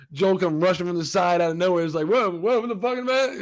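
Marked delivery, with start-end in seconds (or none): 2.1-3.4 high, agitated intervention; hammed-up outraged whoa; mock alarmed protest. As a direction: rush in to break up a fight. Acting Joel rushing in.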